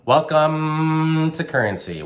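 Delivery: long, high, loud held greeting; drawn-out show welcome. Pretend. Host greeting listeners to the show, drawn-out welcome. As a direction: stretch it out like a big announcer intro.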